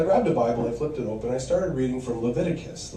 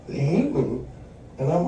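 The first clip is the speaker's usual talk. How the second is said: ordinary talk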